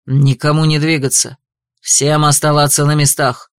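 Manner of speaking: low, loud commanding boom. A narrator's reading. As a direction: thunder the order over the crowd. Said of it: "прогремел голос Рейтрана".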